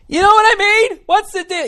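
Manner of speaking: exasperated high-pitched yelp; high shriek. Real, not acted. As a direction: squeal the question way up, loud and strained. Escalating rant, shrieking repeat.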